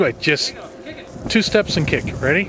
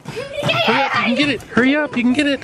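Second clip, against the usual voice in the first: excited cheering; 11 semitones higher than usual. Real, not acted. Urging child to chase ball.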